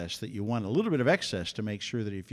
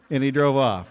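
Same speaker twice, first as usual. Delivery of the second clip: ordinary talk, nothing marked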